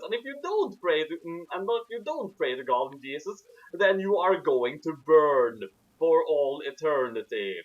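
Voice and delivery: ordinary talk, nothing marked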